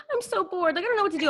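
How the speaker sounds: high, soft whining mom; whiny mom. Retold or imitated.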